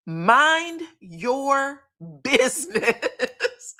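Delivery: very slow, dismissive mind your business; drawn-out brush-off. Retold or imitated. Doctor brushing off the table guests, slow and dismissive. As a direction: stretch it out slowly, waving them off. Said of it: "he said".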